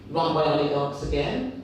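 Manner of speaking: usual speaking voice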